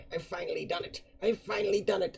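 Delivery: put-on high, cracking old man crowing in triumph; gleeful old prisoner. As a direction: crow with delight like a mad old digger.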